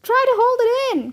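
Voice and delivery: high, urgent call. A narrator's reading. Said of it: "called Eric".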